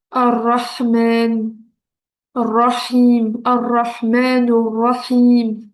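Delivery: "Ar-Rahman", "Ar-Rahim" drawn out slowly with audible H; stretched "Ar-Rahman", "Ar-Rahim", H clearly breathed from the throat; pronounced Arabic H. Shown as a demonstration. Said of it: "on prononce le H".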